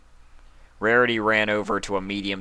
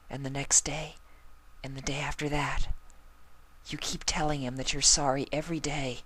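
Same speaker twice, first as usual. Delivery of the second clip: soft, high breathy-quiet pony; Fluttershy voice; 8 semitones higher, 7 dB quieter than usual. A narrator's reading. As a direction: murmur it gently and shyly. Fluttershy speaks softly throughout.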